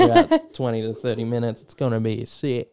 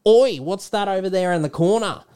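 put-on high-pitched, exclaimed Australian accent; Aussie accent; 7 semitones higher, 3 dB louder than usual. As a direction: call it out as a broad Aussie. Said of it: "this is an Aussie doing it".

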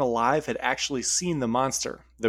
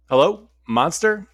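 nervous call; 6 semitones higher, 7 dB louder, slower than usual. Acted. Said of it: "hands shaking but ready".

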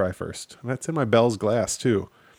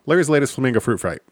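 said quickly; 3 semitones higher, 5 dB louder, much faster than usual. Shown as a demonstration. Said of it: "I'm gonna try that quick".

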